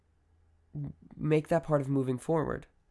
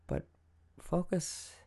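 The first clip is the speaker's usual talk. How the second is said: ordinary talk, nothing marked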